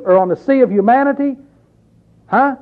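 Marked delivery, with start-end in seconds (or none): none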